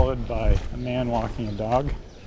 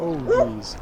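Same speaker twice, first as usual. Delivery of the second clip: startled. Real, not acted. Startled by barking dog.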